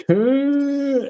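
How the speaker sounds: high nerd's drawn-out cry; nerdy Khan yell. Retold or imitated. Seth Rogen's nerdy Trekkie, high mock wail. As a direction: wail the name like a big nerd. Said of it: "he just goes".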